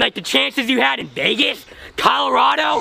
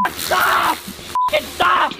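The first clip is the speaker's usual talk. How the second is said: shouting stop; 6 semitones higher, much slower than usual. Real, not acted. Being sprayed with hose.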